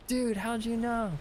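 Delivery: high-pitched imitation of a baffled friend; retold incredulous question in a high voice; mock astonishment. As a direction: voice your friend's amazed reaction, pitched well up. Retold friend caught faking accent.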